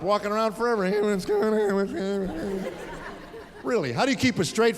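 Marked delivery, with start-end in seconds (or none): 0.8-2.6 lightly imitated squeaky teen; unbroken high teen voice. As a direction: play the big kid whose voice never dropped. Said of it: "voice never dropped".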